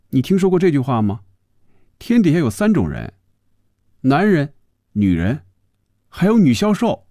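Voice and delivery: high-pitched, mildly downcast woman's voice; sigh, then lament in a high voice; wistful. A narrator's reading. Phoebe feels Hongjun thinks her childish, mildly wistful. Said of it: "神情变得忧郁起来".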